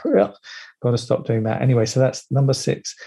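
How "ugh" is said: disgusted ugh. Shown as a demonstration. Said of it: "passive-aggressive".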